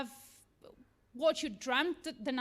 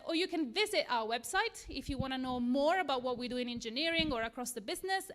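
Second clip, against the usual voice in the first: ordinary talk, nothing marked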